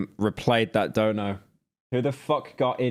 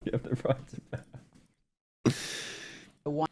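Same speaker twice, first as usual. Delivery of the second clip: very soft whispered repeat; hushed, voiceless murmur; 10 dB quieter, slower than usual. Pretend. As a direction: whisper it under your breath. Repeats line nearly voiceless, jokingly.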